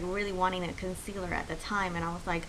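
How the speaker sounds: normal talking voice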